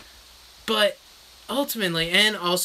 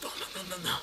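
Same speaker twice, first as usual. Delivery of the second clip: muffled refusal; 2 semitones higher, 11 dB quieter, faster than usual. Retold or imitated. Friend refusing with mouth full.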